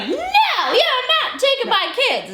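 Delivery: high, drawn-out mock protest; defiant Judy. Judy refusing her ex-husband, high mock defiance. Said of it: "And she's like".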